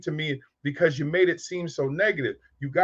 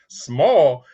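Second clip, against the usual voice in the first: Small said loud and falling, for the room to hear; loud repeat; 6 dB louder than usual. Retold or imitated. The pharmacist repeats the boy's answer for everyone to hear, loud. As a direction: blare it back in disbelief. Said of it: "He said".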